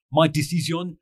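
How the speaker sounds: light imitation of a French official, "My decision is final decision" in French accent. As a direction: mimic a French race director's French accent, half joking. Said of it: "in his very French accent said".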